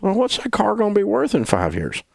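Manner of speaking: high-pitched scolding question; challenging grandpa. Retold or imitated. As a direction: imitate a grandfather demanding an answer. Grandpa challenges him, acted.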